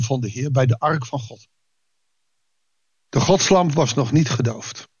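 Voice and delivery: measured, formal Bible reading voice; lightly formal reading from the pulpit; scripture reading. Acted. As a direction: read the passage to the congregation with calm weight. Reads Bible passage aloud.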